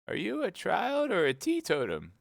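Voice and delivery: put-on a fussy old sheep at her counter. A narrator's reading. The sheep asking Alice what she wants, old sheep voice.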